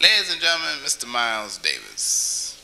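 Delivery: announcer. Acted. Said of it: "announcing Miles".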